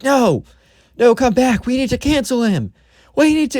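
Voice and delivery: high, whiny mock plea; pleading girl. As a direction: call them back in a high, desperate whine. Girl begs leaving crowd.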